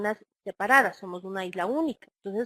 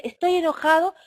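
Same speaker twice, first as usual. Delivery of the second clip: louder and higher, a staged angry claim; mock angry; 6 semitones higher, 7 dB louder than usual. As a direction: act out someone declaring anger. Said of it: "uno dice".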